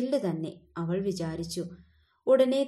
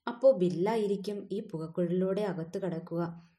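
ordinary delivery

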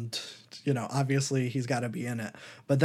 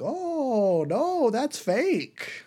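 high, very slow, scoffing brush-off; mock dismissive; 11 semitones higher, much slower than usual. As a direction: drag it out like you can't believe it. Characters dismiss scientist, retold scene.

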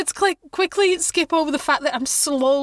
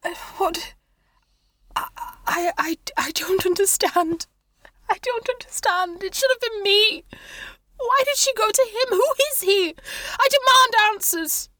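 distraught, tearful character; 9 semitones higher than usual. Acted. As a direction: start faint and faltering, climb to anguished insistence. Tearful character is confronted in game.

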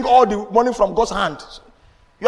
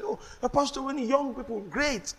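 softer, higher-pitched imitation of admirers; hushed, higher mock admiration; imitating well-wishers; 5 semitones higher, 8 dB quieter than usual. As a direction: lightly voice an admiring church member. Acts out people flattering the pastor.

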